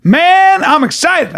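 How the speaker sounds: high, emphatic put-on voice; drawn-out, high-pitched enthusiasm; mimicked excitement. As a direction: imitate a thrilled shopper. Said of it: "You're excited about those shoes".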